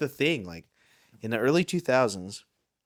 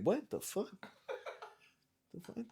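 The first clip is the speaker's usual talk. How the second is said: baffled player; 12 semitones higher, 11 dB quieter than usual. Retold or imitated. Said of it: "You're like".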